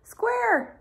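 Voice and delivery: a lifted, friendly character call; Circle's voice. A narrator's reading. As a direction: call his name up high. Circle calling to Square.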